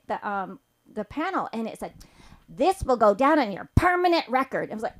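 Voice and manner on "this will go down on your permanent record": Karen voice. Retold or imitated. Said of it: "they got a Karen on there too".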